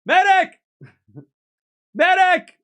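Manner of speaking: high, loud mock cry for a medic; hammed-up call for the medic; man down shout. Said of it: "Oh, Chad, I'm injured".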